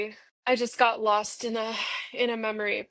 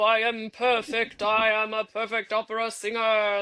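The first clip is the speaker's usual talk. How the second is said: pompous opera singer. Retold or imitated. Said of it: "not joy to be brought with".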